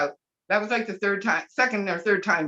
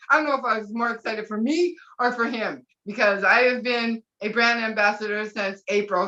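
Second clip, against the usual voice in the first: normal talking voice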